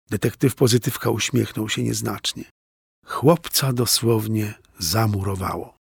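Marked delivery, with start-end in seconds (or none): none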